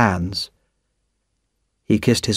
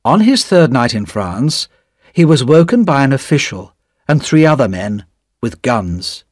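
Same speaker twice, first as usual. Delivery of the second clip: plain reading voice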